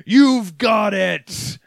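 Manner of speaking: loud, slow ghostly shout; hammed-up monster yell. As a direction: bellow it like the monster. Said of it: "The voice just yells out".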